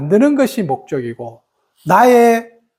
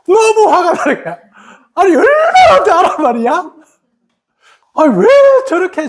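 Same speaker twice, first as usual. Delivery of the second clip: exasperated; 7 semitones higher than usual. Retold or imitated. Recalling anger over the teacher's preference, mock exasperated. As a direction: lean on 너무 with a pitched-up huff.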